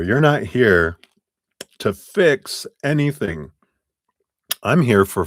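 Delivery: reading while chewing a cough drop; words round a crunchy cough drop; mouth full. Real, not acted. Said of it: "let me finish eating my".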